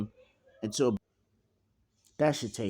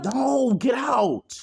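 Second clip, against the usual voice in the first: high, loud panicked cry of a villain being beaten; panicked scream; 14 semitones higher, 7 dB louder, much slower than usual. Acted. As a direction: yelp it out high and loud in terror. Erlong screams as Luffy attacks.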